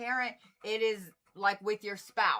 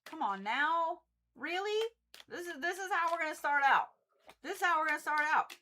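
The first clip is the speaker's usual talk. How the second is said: much higher, incredulous complaint; higher and faster on the repeat, fed up; exasperated disbelief; 6 semitones higher than usual. Real, not acted. Reader scolding the cards at the start, exasperated. Upset at the opening card.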